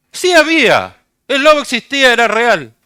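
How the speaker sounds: loud, high-pitched insistence; forceful preacher's correction; emphatic answer. Real, not acted. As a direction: raise your voice to drive the answer home. Preacher emphatically answers his own question.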